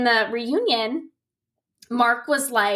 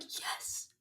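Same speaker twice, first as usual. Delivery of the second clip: hushed, whispered yes; very soft retold whisper; whispered cheer; 19 dB quieter than usual. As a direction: whisper it like a private little victory. Retelling own delighted reaction.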